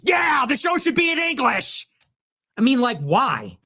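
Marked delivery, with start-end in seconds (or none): 0.0-1.9 high, hammed-up cheering yell; mock rabble-rousing shout. Host acting fan up on the coffee table, mock shout. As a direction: whoop it like a fired-up fan. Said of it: "you wanna get up on the coffee table?".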